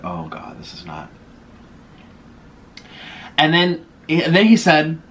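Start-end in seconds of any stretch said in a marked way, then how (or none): 0.0-1.1 very low, soft, fading murmur; dismayed aside. Retold or imitated. Thinking to herself during the reading, dismayed murmur. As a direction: let it sink away like a private thought. Private dismayed thought, five minutes in.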